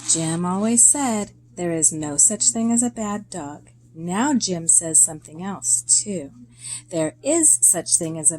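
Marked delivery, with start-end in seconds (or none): none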